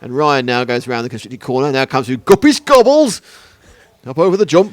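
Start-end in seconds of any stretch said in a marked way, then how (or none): 1.7-4.7 put-on northern accent. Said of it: "Is that a Lancashire accent or a Yorkshire accent".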